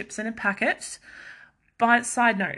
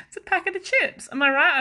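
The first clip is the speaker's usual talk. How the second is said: high unsure self; 10 semitones higher, 5 dB louder than usual. Retold or imitated. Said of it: "It's like".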